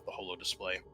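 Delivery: ordinary talk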